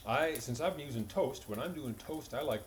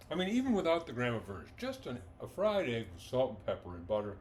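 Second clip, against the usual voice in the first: ordinary delivery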